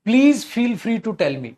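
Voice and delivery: slow reading. Shown as a demonstration. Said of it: "reads question slowly".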